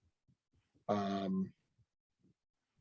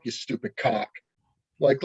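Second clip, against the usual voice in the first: ordinary delivery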